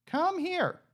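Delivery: high coaxing call. Real, not acted. Player coaxing pig Arthur into the pen, high call.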